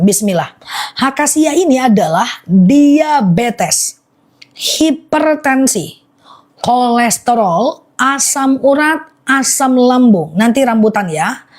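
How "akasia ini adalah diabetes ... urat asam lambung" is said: very slow, each ailment set apart; slow, deliberate repeat. Real, not acted. Seller repeating the honey's uses to live buyers, slowly. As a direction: spell out the list slowly, word by word. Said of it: "Aku ulang pelan-pelan".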